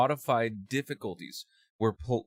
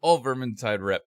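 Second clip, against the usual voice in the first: loud hype; 4 semitones higher, 8 dB louder than usual. Real, not acted. Hyped chant of team rep.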